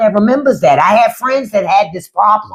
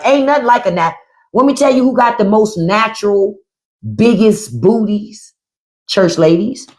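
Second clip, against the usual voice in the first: ordinary delivery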